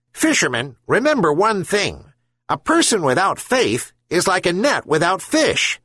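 high gull voice, solemn farewell advice; wise herring gull. A narrator's reading. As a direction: impart the lesson like a wise seabird. Gull's parting lesson.

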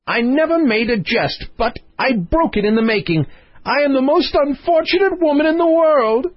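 a queen wailing her misfortune to her husband; lamenting queen. Acted. Queen bemoans her luck to the king, high and plaintive.

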